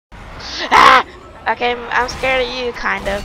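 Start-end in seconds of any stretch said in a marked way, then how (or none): 0.1-1.1 sharp breathy shout of fright; gasp-yell as something jumps out; startled yell. Real, not acted. Gamer shouting at a sudden attack.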